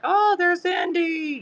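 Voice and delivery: high, slow, drawn-out sing-song call; a stretched, sing-song hello to the bird; imitating everyone's greeting. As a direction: croon it up high like people greeting a peacock. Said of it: "and everybody's like".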